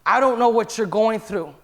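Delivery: ordinary delivery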